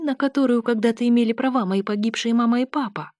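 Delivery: ordinary delivery, nothing marked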